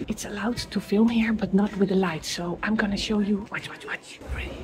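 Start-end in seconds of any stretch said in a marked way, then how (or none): 3.5-4.5 hushed urgent whisper. Real, not acted. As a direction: keep it a whisper inside. Whispering while filming inside.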